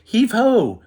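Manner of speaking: high, loud heave-ho shout; a mouse hauling water, shouting; yell. Acted. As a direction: yell it as you throw. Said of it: "Mouse yelled".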